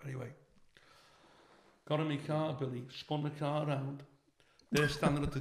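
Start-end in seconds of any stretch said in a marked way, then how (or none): none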